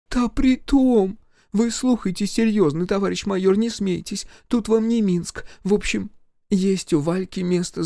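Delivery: village mother-in-law. Acted. Mother-in-law scolding her husband at the wedding. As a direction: scold the drunk husband, then coax the major.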